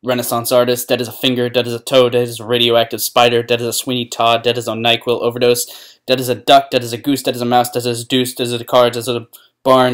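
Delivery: loud, driving, even-pitched recitation; relentless loud monotone rattle; rattled-off list. Acted. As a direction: reel off a list at full volume without letting up. Rapid loud comic list.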